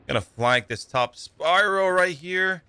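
usual speaking voice

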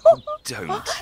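high, loud laughing protest; embarrassed laugh. Acted. Son laughs as his mother teases him. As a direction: laugh it off, begging her to stop.

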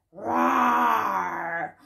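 low, drawn-out playful roar; a gentle pretend lion for little ones. Teacher showing toddlers the lion, low mock roar. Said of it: "The lion says".

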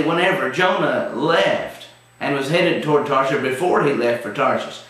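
ordinary talk

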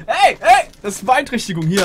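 sudden high shout; loud protest. Real, not acted. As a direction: cut in with a sharp shout. Outburst at friend crunching chips.

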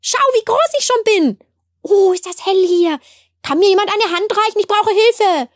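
little sprouts calling out all at once; excited little voices. A narrator's reading. Countless sprouts call out excitedly, high and loud. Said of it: "Unzählige Stimmchen, die aufgeregt riefen".

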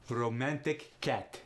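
slow "romantic cat" with eh vowels, Russian; Russian accent, "cat" said like "ket"; Russian eh sound. Shown as a demonstration. [name] shows a third variation, Russian eh sound. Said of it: "there you have the eh sound".

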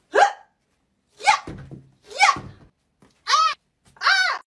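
short throwing grunts, high; acted combat cries; high-pitched effort yelps. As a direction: yelp with each throw. Skit of chancla being thrown.

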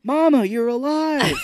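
high, loud put-on amazement; hammed-up shout to his mother; mock overjoyed cry. Imitating son as Mama wakes up, mock overjoyed.

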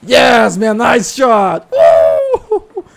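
loud, high, slow whoop of triumph; elated victory shout; cheering. Real, not acted. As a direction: celebrate the shot at full voice. Cheering teammate's kill.

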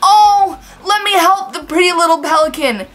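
high, loud gushing coo; mock drunk lady. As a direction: imitate an overeager lady fussing over an animal. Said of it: "she goes".

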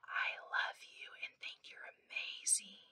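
soft secret whisper. Shown as a demonstration. Teacher whispers a secret to her class, whispering. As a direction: breathe it like a secret. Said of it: "I'm gonna whisper you one".

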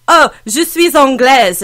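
slow French in a put-on British accent; deliberately clumsy English accent on the French; bad British-accented French. Shown as a demonstration. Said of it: "I would just put on this really bad".

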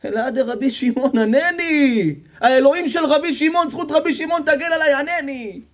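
pleading cry. Acted. A desperate man calling to God, high and pleading. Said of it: "והתחיל לצעוק".

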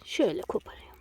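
whispered aside; whispering. Real, not acted. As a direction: breathe it softly while picking. Quiet aside while picking plants.

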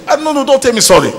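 high-pitched, exasperated outburst; worked-up, indignant protest; acted angry refusal. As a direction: refuse the apology in mock temper. Acts out refusing wife's apology.

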